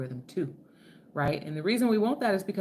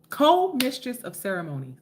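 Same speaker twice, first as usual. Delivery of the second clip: proud announcement; 6 semitones higher, 4 dB louder than usual. Real, not acted. Proudly repeats her title.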